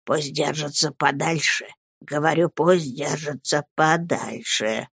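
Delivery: low, soft, insistent warning; menacing whisper. A narrator's reading. Headmaster warning someone off, insistent whisper. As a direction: repeat the warning under your breath. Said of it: "зашептал мистер Крикель".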